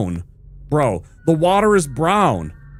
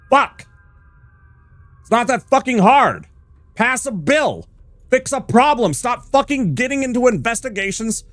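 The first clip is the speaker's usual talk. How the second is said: angry rant; 6 semitones higher than usual. Real, not acted. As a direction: hammer each order out in real anger. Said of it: "I have to get so fucking angry".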